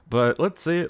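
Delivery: ordinary talk, nothing marked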